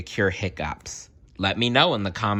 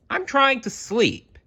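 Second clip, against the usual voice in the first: much higher tiny mouse complaining; squeaky mouse voice. Acted. Mouse complaining to the animals, high squeaky voice.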